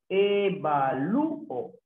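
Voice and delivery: "Evalúo" slowly split into E-va-lú-o; teacher sounding out "Evalúo" in pieces; syllable by syllable. Shown as a demonstration. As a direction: stretch each syllable of "Evalúo", lifting lú. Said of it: "cómo se separa".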